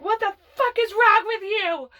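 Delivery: sharp and high, a retold angry outburst; angry sister yelling. Older sister snapping at the thirteen-year-old, angry yell. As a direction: throw it back at her like the sister did.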